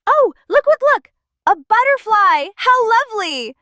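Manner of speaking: high, excited burst of wonder; thrilled and high-pitched; delighted discovery. Pretend. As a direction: point out a lovely surprise to small children, pitched high. Spots a butterfly with delight.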